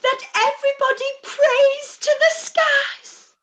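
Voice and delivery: wailing lament. Acted. Mother wailing to her sister about Wickham. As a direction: wail it out as if the family is ruined.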